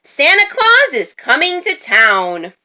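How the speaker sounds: high, announcing call; storybook yell. A narrator's reading. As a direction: proclaim it excitedly to everyone. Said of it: "Minnie yelled".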